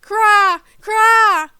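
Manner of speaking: high, loud parrot screech; bird scream. A narrator's reading. Netted bird screaming in pain. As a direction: shriek like a trapped parrot. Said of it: "Then he screamed".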